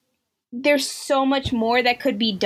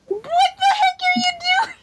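very high, shouted disbelief; outraged shout; faster than usual. Retold or imitated. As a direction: scream it at the screen. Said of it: "and I'm like".

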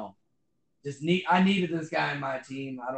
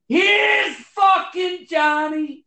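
huge, high, drawn-out yell; full-throated shout, stretched out; shouted movie quote; 10 dB louder, much slower than usual. Acted. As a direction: holler it like a manic big entrance. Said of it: "Sorry, that just ruined the auto".

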